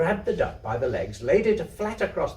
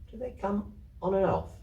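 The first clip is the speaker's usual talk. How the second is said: put-on higher, wondering little girl's question; surprised child; 6 semitones higher than usual. A narrator's reading. Kezia, amazed at Pat's earrings, asks in surprise. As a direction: ask it amazed, wide-eyed. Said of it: "She was very much surprised".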